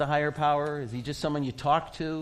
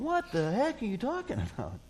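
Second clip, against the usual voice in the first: mock disbelief; 8 semitones higher than usual. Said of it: "these guys are going".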